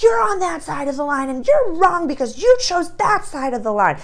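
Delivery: high, mocking scold; mock screaming at the other side. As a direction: sneer at someone across the line. People screaming across the line.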